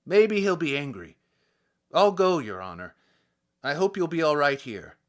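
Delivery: the peasant policeman fretting to his superior; loud worried policeman; humble policeman. Acted. Policeman answers the official.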